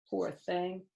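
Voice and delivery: ordinary delivery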